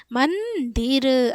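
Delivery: मंदिर slowly split, long humming n after म; nasal anusvar demo. Shown as a demonstration. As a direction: hold मं in the nose, then दिर in मंदिर. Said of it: "इस पे भी नाक पे बल जा रहा है".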